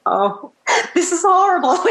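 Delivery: high, laughing mock horror; mock dismay. Singer predicting the host's reaction, mock dismay. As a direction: play the listener's dismay, breaking into laughter. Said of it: "You're gonna be like".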